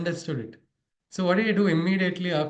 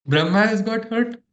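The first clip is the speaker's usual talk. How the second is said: higher, louder wounded protest, retold; hurt complaint; 7 semitones higher, 5 dB louder than usual. Injured disciple complains about his guru, mock hurt. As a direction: act out an indignant injured cry. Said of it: "Now this person is hurt".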